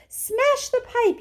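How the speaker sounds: high, loud, slow yell; mother yelling to smash the pipe. Acted. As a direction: yell the order. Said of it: "yelled Missus Gloop".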